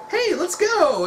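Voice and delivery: high, bouncy mock "let's go"; eager battle cry. A son joining the charge, high eager shout. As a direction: jump in high and eager like a kid tagging along. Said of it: "Gohan's like".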